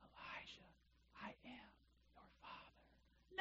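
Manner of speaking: mock dramatic whisper; joking whisper. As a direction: whisper it like a famous film reveal, for a laugh. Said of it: "That was, that was a joke".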